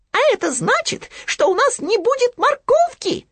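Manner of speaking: high squeaky little piglet. Acted. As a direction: pitch it way up like a tiny piglet. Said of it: "добавил Хрюня". (писклявый голосок Хрюни)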